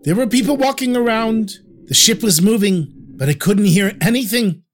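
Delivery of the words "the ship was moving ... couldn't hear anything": ordinary delivery, nothing marked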